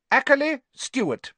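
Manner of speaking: high, projected roll call; calling out names. A narrator's reading. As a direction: call the next pupil up. Teacher calls out name.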